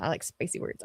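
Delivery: reading the comment in a whisper; whispered aside to chat. Acted. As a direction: whisper the words.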